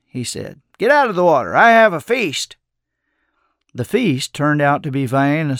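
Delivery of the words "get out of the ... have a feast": raised, inviting call; hearty summons to come and eat. A narrator's reading. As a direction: pitch lifting on the feast. Old man calls boy from water.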